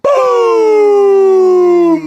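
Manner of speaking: high loud hype shout; hyped shout-out. Real, not acted. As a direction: yell the name out with hype. Shouting out a chat donor.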